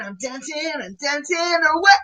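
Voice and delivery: high playful chant. Acted. Presenter dancing along, playful high voice. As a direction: bounce it out while dancing.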